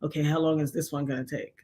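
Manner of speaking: low, soft inner grumble; soft, weary thought in the dark; quiet resignation. Retold or imitated. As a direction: mutter it to yourself. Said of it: "sit in the dark for a while like".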